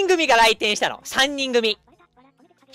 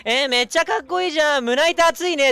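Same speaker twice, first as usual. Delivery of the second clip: playfully raised flirty voice; flirty woman; 5 semitones higher than usual. Retold or imitated. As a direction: coo over him like a seductress. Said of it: "そこら中の男をはぶらかしてさ".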